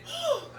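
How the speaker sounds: high woo breaking into a laugh; giddy breathless woo; laughing whoop. Real, not acted. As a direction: whoop out through the strain. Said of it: "It's burning".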